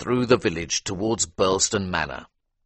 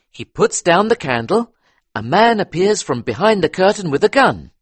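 higher, louder eager police inspector; 10 semitones higher, 7 dB louder than usual. A narrator's reading. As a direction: talk it through like a keen detective. Inspector reconstructs crime.

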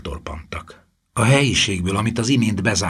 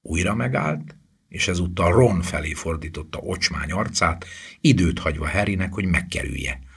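ordinary delivery, nothing marked

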